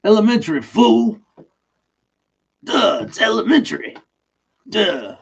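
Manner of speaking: hammed-up high, loud tough-guy Watson; Mister T. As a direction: push it high and loud, cocky and dismissive. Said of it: "Mister T would be a better Watson".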